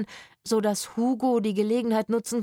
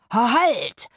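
higher, louder halt order; commanding shout; 4 semitones higher, 6 dB louder than usual. A narrator's reading. Itzik holding the pistol, commanding shout. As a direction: yell it to stop them. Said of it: "rief er".

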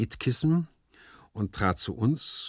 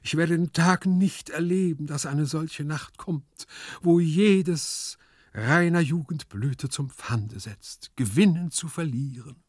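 slow, raised theatrical longing; yearning lover figure; 8 semitones higher, 4 dB louder than usual. A narrator's reading. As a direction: declaim your yearning with drawn-out peaks. Gumpellino declaims longingly in the story. (schmachtend deklamiert, gedehnt)

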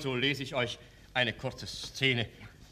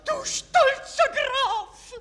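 sobbing accusation; 6 dB louder than usual. Acted. Wronged mother confronts count.

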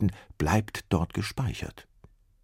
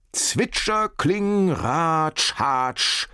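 sound effects; 10 semitones higher, 4 dB louder, much slower than usual. A narrator's reading. Quick thoughts as sound effects.

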